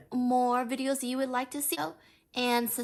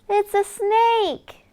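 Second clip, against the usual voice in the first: higher and louder surprised announcement; excited reveal; 9 semitones higher, 9 dB louder, much slower than usual. Acted. Reader revealing the snake to the child, excited.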